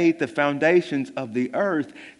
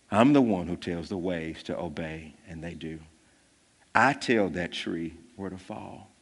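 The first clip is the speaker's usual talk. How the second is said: low, much softer, solemn voice; hushed preaching; 8 semitones lower, 7 dB quieter than usual. Real, not acted. Preacher to his congregation on Jesus' power, hushed and low. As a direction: drop to a near hush to let it land.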